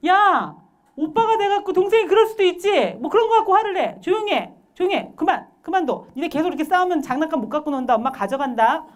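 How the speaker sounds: loud, high-pitched parental telling-off; angry parent piling up orders and threats; threatening scolding, acted. Parent breaking up siblings' fight, threatening. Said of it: "이렇게 위협, 협박".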